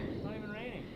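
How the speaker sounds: dry whispered retort. Real, not acted. Brushing off her storm worry, whispered. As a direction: breathe it out, unimpressed.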